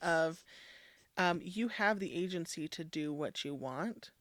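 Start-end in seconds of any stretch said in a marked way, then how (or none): none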